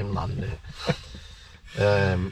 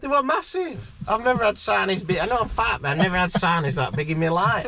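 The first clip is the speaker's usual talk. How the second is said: excited; 8 semitones higher than usual. Real, not acted. Boasting about the huge fish.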